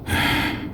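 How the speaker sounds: quiet, almost breathless sigh; soft unvoiced weary sigh. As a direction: sigh away the years. Aging man laments.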